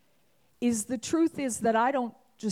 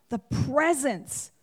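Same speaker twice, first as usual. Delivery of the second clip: ordinary talk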